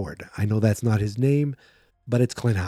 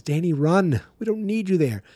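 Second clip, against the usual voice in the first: high, urgent plea to flee; pleading warning; 7 semitones higher than usual. Retold or imitated. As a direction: beg him to run. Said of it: "my first thought is".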